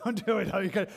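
amused, laughing it off. Real, not acted. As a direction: repeat it through a laugh. Repeat of warning, laugh heard.

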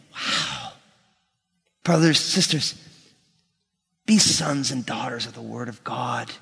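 soft, low near-whispered wonder; hushed awe. Retold or imitated. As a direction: voice Paul's quiet amazement, pausing between phrases. Paul awed by his discovery.

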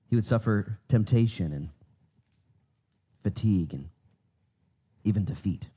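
reverent closing prayer. Acted. Closing prayer before the sermon.

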